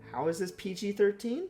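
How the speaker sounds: ordinary talk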